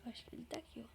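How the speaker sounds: sneaky whisper. Real, not acted. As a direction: whisper so he can't hear. Sneaking up to find Gabriel.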